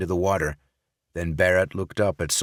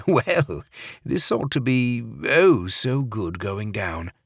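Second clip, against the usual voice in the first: higher, slow savouring fake English accent; 4 semitones higher, slower than usual. Barrett about to drink, fake English accent. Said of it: "in his fake English accent".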